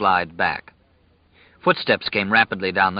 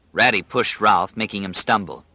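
plain reading voice

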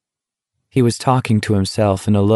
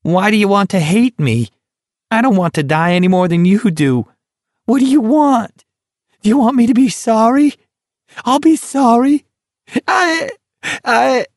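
very high, louder pleading whine rising to a shriek; a frantic high-pitched plea, louder; desperate whine; 13 semitones higher, 3 dB louder than usual. Acted. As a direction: beg frantically, voice climbing. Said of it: "Barkovich was whining".

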